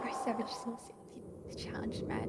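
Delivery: soft breathy whisper reading. Real, not acted. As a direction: whisper the line to the game. Whispered reading of game text.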